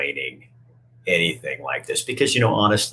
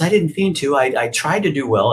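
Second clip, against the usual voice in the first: higher, a touch of upset; higher pleading puppet; 8 semitones higher than usual. Retold or imitated. Punchinella pleads with the toy maker, higher and upset. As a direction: plead your case like a small scared toy.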